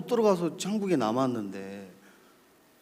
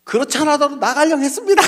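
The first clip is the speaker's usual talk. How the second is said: high, very loud retort; acted brazen outburst; defiant shout; 12 semitones higher, 10 dB louder than usual. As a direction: yell back boldly at your superior. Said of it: "목사님한테 막 큰 소리 치면서".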